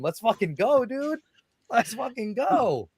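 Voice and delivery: high, drawn-out rallying cry; hyped cheer. Retold or imitated. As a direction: cheer yourself on, stretching the end. Acting out being hyped in ring.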